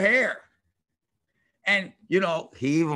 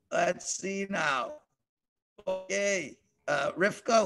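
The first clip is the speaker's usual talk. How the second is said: ordinary delivery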